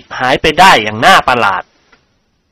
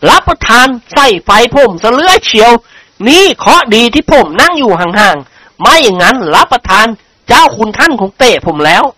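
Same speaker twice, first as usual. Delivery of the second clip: lightly voiced aggrieved servant; a hint of a whining underling; protesting servant character; 7 semitones higher than usual. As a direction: play a servant complaining, pitch raised.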